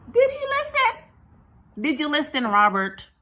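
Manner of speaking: loud, high-pitched rhetorical demand; mock scolding. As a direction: squawk the question, then scold him by name. Scolds the absent singer.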